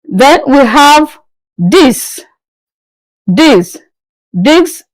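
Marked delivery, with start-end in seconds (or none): 4.3-4.8 higher, this said as deece; French dix. Shown as a demonstration. Teacher modelling dix with a lift. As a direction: model dix, spelt this, rising. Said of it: "That's ten in French".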